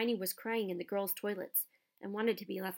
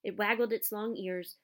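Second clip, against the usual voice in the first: plain reading voice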